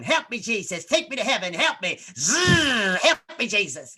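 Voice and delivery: hammed-up bedroom moaning to Jesus; mom's noises. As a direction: moan the prayer in mock rapture. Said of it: "noises from her room going".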